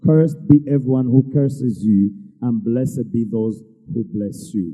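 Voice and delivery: measured, solemn reading of the verse; scripture reading. Acted. Preacher reading scripture to the church, solemn. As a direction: read the blessing out to the congregation.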